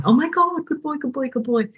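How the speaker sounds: high, excited praise for the dog; mock gushing praise. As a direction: gush over the dog like it just sat. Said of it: "then you bang on".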